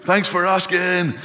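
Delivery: very high, loud comic yell; exaggerated high-pitched shouting back; mock shouted reply. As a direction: holler it back at full volume. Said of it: "if we just shouted".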